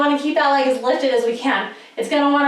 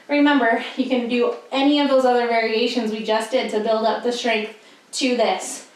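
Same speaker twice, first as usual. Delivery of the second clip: ordinary talk, nothing marked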